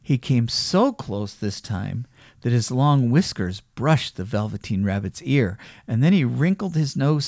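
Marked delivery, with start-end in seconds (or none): none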